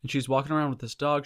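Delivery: normal talking voice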